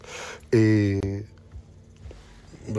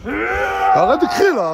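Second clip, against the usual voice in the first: shouting; 12 semitones higher, 4 dB louder, much slower than usual. Real, not acted. Telling the yelling boy off.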